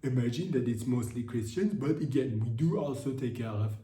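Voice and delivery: spokesman's defensive statement in a put-on Nigerian accent. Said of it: "turn into Nigerian French".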